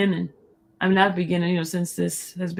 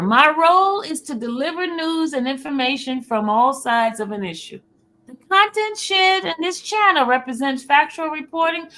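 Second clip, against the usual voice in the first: higher, announcer-style disclaimer; formal disclaimer read; 6 semitones higher, 4 dB louder than usual. Acted. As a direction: read it like an official notice. Reads disclaimer.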